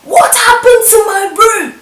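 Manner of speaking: high, loud indignant demand; angry matron. Retold or imitated. Matron confronting the student about her wrecked room, high loud demand. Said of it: "she was like".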